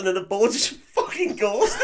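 high, loud, breaking up with laughter; laughing. Real, not acted. Man retells the bull charging, laughing helplessly. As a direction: tell it through real laughter.

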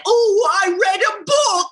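high, drawn-out caricature of British English; hammed-up British accent, slow and high; mock British accent. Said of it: "that freaking accent".

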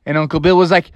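ordinary delivery

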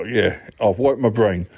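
ordinary talk, nothing marked

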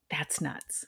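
awed whisper. Real, not acted. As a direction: breathe it in amazement. Awed reaction to history fact.

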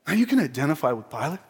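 ordinary talk

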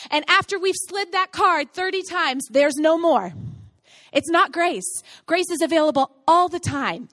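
rising preacher's fervor; forceful preaching. Real, not acted. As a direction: drive it home to the congregation.

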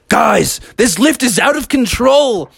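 a touch of panic on the runaway lift; alarmed friends. Acted. Friends on the speeding chairlift, mild alarm. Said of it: "friends on the chairlift were like".

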